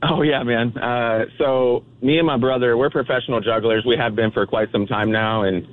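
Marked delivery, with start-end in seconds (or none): none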